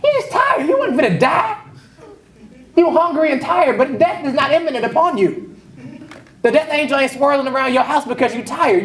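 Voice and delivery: high, teasing sermon punchline; mocking preacher. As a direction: roast Esau for the crowd. Preacher mocks Esau.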